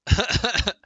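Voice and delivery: short high burst of laughter; sharp laugh. Real, not acted. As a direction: laugh at the joke. Laughing at the survey question.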